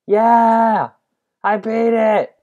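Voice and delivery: loud high victory shout; triumphant cheer. Real, not acted. Finally beating the parkour level, loud triumphant cheer. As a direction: celebrate the win at full voice.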